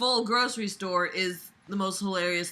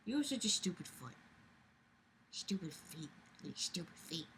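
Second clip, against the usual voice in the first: soft dog talk; 3 semitones lower, 17 dB quieter than usual. Real, not acted. Teasing her dog Sadie about her feet, hushed. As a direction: coo softly at the dog.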